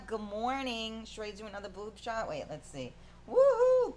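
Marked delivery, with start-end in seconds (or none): 3.3-4.0 high, loud whoop; whooping cheer. Real, not acted. Host cheering for the coffee talk show, whooping.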